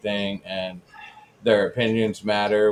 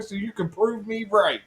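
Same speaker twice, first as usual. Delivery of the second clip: mock challenge; 9 semitones higher than usual. Co-host calling out a commenter for laughs, mock taunting.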